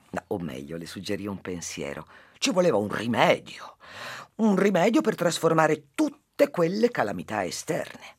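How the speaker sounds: lively storytelling to a listener; story narration. Acted. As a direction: tell the fable with animated character voices. S0 tells a fable. (narrazione di una fiaba)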